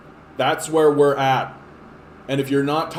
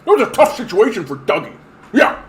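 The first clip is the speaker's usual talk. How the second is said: higher, louder, laughing dopey voter; mocking a naive Ford voter, laughing; 5 semitones higher, 6 dB louder than usual. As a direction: play a gullible supporter. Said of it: "people are gonna be like".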